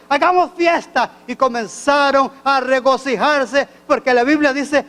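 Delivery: loud, driving pulpit preaching; preaching at full force. Acted. Preacher to his congregation, loud fervent preaching. Preacher retells prodigal son.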